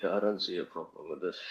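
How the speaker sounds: faint, cutting-out phone line; radio breaking up. Real, not acted. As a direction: voice fading in and out on a bad connection. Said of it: "Okay, you know what".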